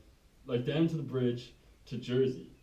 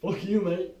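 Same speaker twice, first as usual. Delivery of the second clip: louder, higher mock defiance; defiant retort; 6 semitones higher, 5 dB louder, slower than usual. Pilot to the doubter after landing, defiant. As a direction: throw it out like a cocky winner.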